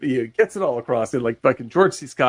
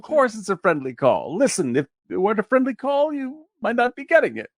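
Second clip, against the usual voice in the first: higher, sugary reassurance with a barb; passive aggressive; 9 semitones higher than usual. Retold or imitated. President soothing the furious premier, passive aggressive. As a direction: placate him while sneaking in a threat. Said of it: "he's so passive aggressive".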